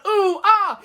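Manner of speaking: high, loud laughing gasps of a thrilled viewer; mock gasps. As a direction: gasp and laugh like you're riding it. Mimicking a viewer's reactions.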